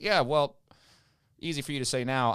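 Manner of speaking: indignant retort. Retold or imitated. Retort to girlfriend's apology.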